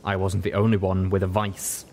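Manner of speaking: ordinary delivery, nothing marked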